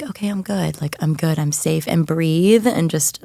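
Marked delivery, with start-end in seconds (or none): 0.0-1.9 much softer, settling herself after stress; soft self-reassurance. Retold or imitated. A mother reassuring herself after a hard moment, hushed. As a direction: quietly tell yourself you're safe.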